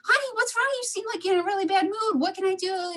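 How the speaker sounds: high and fast, over-eager sweetness; eager concern. Retold or imitated. Wife pressing her tired husband, high and hurried.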